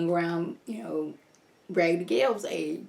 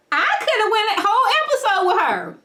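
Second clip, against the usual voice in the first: excited gush; 13 semitones higher, 5 dB louder than usual. Real, not acted. Gushing to viewers.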